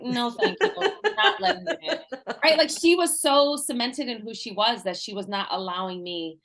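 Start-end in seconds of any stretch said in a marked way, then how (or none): 0.0-2.3 laughing mock brush-off. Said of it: "she had, like, a force field".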